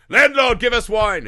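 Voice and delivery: loud, high mock demand; rowdy demand. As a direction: call out like an entitled customer. Acting out rude customers' demand.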